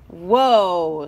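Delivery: loud, low interruption; cutting in. A narrator's reading. Scissors halts Highlighter's plan, cutting in. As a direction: break in with a firm halt. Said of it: "Scissors cut in".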